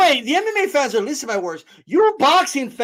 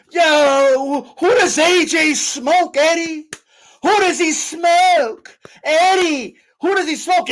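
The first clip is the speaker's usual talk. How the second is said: higher, slow, drawn-out mocking call; mock hype talk; 4 semitones higher, slower than usual. Streamer imitating dudes like Ariel Helwani, mock hype. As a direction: stretch each question out like a challenge.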